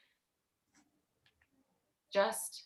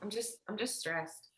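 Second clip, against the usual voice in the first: stressed; 3 semitones lower, 15 dB quieter than usual. Acted. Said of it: "I'm stressed".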